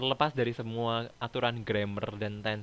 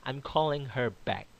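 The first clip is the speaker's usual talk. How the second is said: slowed model sentence, lightly voiced; slow example sentence; 2 semitones higher, slower than usual. Shown as a demonstration. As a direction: spell it out slowly for learners. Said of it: "teacher demonstrates example sentence". (contoh kalimat diucapkan pelan dan jelas)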